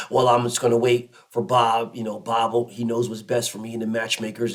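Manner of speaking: low, lazy mock voice of fans waiting on promoters; mocking passive fans. Mocking fans who wait for promoters, low lazy voice. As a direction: drop the voice and mimic the sheep. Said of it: "Everybody else is used to the status quo".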